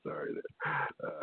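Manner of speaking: slow, soft, laughing out an apology; breathless laugh. Real, not acted. Host apologizes to listeners, still laughing softly.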